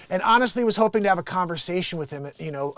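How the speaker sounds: ordinary delivery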